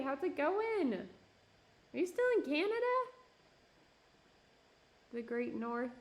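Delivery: ordinary talk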